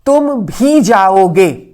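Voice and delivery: "तुम भी" very slow and loud; stress on तुम भी. Shown as a demonstration. Teacher demonstrating emphasis with "तुम भी", slow and loud. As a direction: press down on "तुम भी", drawing it out. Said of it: "stress डालने के लिए".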